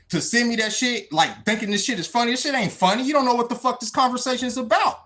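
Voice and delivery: usual speaking voice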